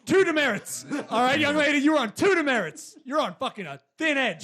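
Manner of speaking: principal scolding. Pretend.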